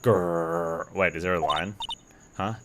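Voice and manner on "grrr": imitated big-cat rumble; tiger growl. Voices the game's tiger growling.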